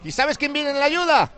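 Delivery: projected radio football call; live play-by-play. Real, not acted. Commentator sets up a free kick for listeners, live play-by-play. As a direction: stretch out key words to build suspense.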